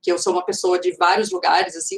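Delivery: normal talking voice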